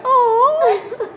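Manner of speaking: very high, drawn-out "oh" of affection; adoring coo. Retold or imitated. Mother looks at her smiling baby's face, adoring coo. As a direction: coo as if melting at a baby.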